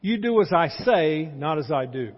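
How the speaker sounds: loud, preachy parental retort; hammed-up bossy parent laying down the law; mock stern parent. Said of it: "here's the response I got".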